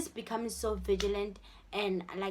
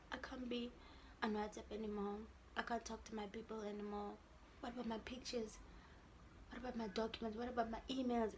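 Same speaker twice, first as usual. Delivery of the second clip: much softer, downcast worrying aloud; retold dejection; 11 dB quieter than usual. Girl picturing life without her phone, subdued dejection. Retold depressed what-if worries.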